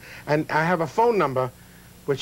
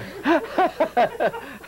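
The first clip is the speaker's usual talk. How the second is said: high, airy burst of laughter; laughing at own joke; 10 semitones higher than usual. Real, not acted. Host laughing at his own [name] joke. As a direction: cackle at your own punchline.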